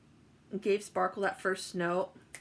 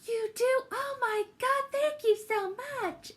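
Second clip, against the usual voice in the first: much higher, louder gushing teacher voice; delighted teacher; 4 dB louder than usual. Retold or imitated. Imitating the teacher thrilled to receive a note.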